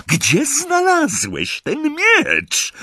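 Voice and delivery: high, slow, insistent demand; fierce interrogation. A narrator's reading. Bellatrix questions werewolf at blade point, fierce. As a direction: press him with a drawn-out question.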